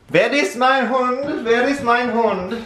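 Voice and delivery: put-on high, loud German-accented holler; mock German call. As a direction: call the dog in a booming fake German accent. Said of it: "Call for Quincy in German".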